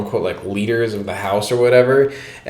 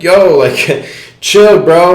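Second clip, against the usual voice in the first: calling out; 7 semitones higher, 8 dB louder, much slower than usual. Retold or imitated. Homie calming things down.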